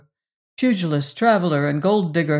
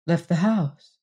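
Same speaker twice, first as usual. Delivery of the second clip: ordinary delivery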